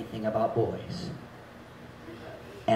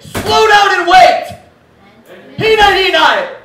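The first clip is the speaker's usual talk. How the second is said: very high, loud and slow, each word held; slow preaching cry; 16 dB louder, slower than usual. Acted. Pastor warning girls about boys, slow loud cry. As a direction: plead with them to slow down.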